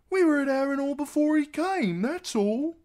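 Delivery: put-on very high, loud boyish denial; blurting boy. A narrator's reading. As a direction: protest like an anxious-to-explain lad. Hot Pie blurts out an explanation.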